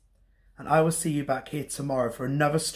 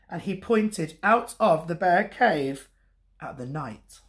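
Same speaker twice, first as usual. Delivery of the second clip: ordinary delivery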